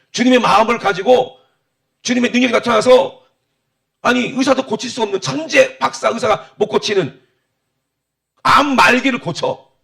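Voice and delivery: loud, high-pitched preaching with punched phrases; forceful preaching. Real, not acted. (힘주어 외치는 설교)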